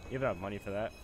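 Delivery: usual speaking voice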